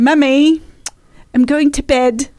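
put-on little British girl's bedtime announcement; British accent. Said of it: "instead of saying".

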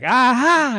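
high triumphant aha; gotcha cops. Retold or imitated. Cops finding the powder, high triumphant aha. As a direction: catch him red-handed. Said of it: "They're like".

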